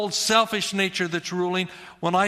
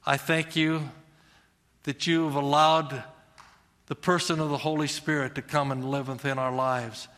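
prayer; 3 semitones lower than usual. Real, not acted. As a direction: pray gently over the room. Pastor prays aloud.

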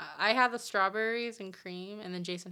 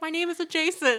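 mock stranger; 9 semitones higher, 7 dB louder than usual. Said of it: "He was like".

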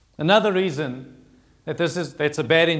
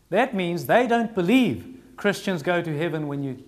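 usual speaking voice

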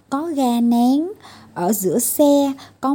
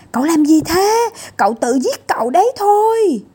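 higher and louder, fast panicked cry; alarmed shout; 8 semitones higher, 5 dB louder, faster than usual. Acted. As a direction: yell at him to stop the madness. Little ones shout at crash.

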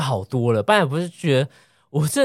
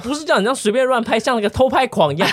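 inner fuming; 6 semitones higher than usual. Retold or imitated. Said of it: "我在气到想说".

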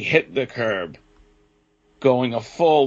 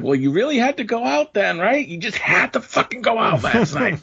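much higher, mocking sarcasm; sarcastic jab; 9 semitones higher than usual. Scolding the daughter about going out, high mocking sarcasm. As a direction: needle her with mock sweetness. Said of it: "and I'm like".

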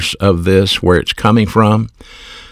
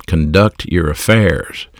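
ordinary talk

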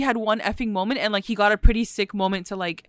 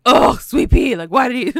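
imitated exasperation; 2 semitones higher, 7 dB louder than usual. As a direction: act out being mad at him. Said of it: "she was so mad about that".

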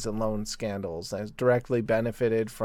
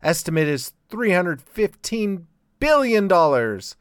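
loud newscaster delivery; headline read; 4 semitones higher, 6 dB louder than usual. Shown as a demonstration. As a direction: announce it like a headline. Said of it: "I clocked the article".

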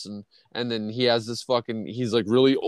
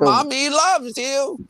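high, crooning mother's voice; Missus Voorhees; 6 dB louder, much slower than usual. Retold or imitated. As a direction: coo it high like a doting, creepy mother. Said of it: "That's what Missus Voorhees always used to say".

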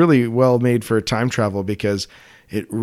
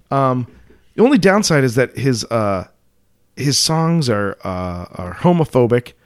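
normal talking voice

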